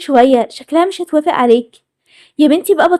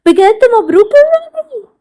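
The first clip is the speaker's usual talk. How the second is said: excited congratulations; 8 semitones higher than usual. Acted. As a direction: gush your congratulations. Reacts to proposal plan.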